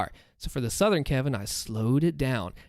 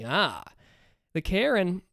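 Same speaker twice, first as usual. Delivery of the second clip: ordinary talk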